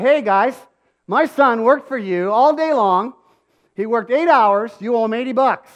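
a father laying down the law; angry confrontation. Retold or imitated. Dad confronting carnies over his son's unpaid wages, angry.

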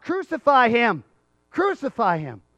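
loud, high mob cry, easing on the repeat; imitated crowd yell. Crowd answering Pilate, imitated insistent yelling. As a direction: yell it out as the mob, then ease. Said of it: "they just keep yelling it out".

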